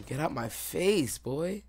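taunting. Real, not acted. Taunting an enemy after a kill.

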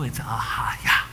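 ahaya breathed out slowly, almost voiceless, like an exhale; Hebrew word breathed. Shown as a demonstration. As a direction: say ahaya as one long soft breath. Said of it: "In Hebrew".

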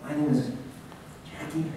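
a shy guest whose name fades to a murmur; mumbled name. Retold or imitated. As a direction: let the name trail off into nothing. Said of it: "their voice ... didn't go up for their name".

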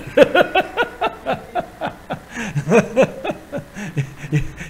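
long wheezy run of laughter; long chuckle. Real, not acted. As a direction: laugh on and on at your own remark. Preacher chuckles at own remark.

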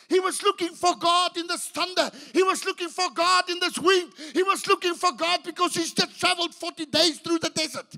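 high, driving repetition; preaching at full force. Acted. Elijah looking for God, preached hard. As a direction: build each he was looking line.

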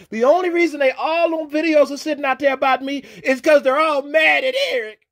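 real taunting excitement; gloating rant. Real, not acted. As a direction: crow over the haters. Gloating tirade at his critics.

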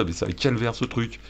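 ordinary delivery

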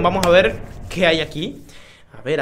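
usual speaking voice

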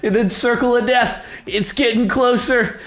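high, giddy taunting, laughing through it; gleeful taunt. Real, not acted. Taunting the trapped snake, giddy and high-pitched. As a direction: cackle at the trapped rival.

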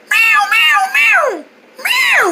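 high repeated siren wail; shrieking bird distress call, like a fire alarm; alarm-like bird cry. Acted. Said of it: "It sounded like a fire alarm".

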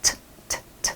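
"ch ch ch" as tiny voiceless puffs of aspirated t, without a vowel; light half-sound. Shown as a demonstration. Teacher modelling the second ending for students, light half-sound. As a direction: release "ch ch ch" lightly, only half a sound each. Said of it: "คือเสียงออกมาแค่ครึ่งๆ".

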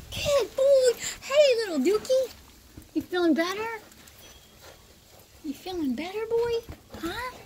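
high, loud squealing praise for a dog. Real, not acted. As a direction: gush over the puppy in a high voice. Baby talk praising the dog.

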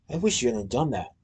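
soft, hushed confession; whispered. A narrator's reading. Noodle whispering her regret to Willie. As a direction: breathe it quietly, almost to yourself. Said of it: "she whispered".

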